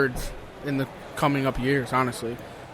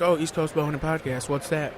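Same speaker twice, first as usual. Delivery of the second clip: seductive radio voice; 6 dB quieter than usual. Acted. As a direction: purr it like a late-night DJ. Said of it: "The, the seductive voice".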